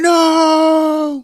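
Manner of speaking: high, loud, drawn-out wail; horrified mock scream. Everyone upset at the fall.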